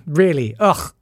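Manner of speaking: normal talking voice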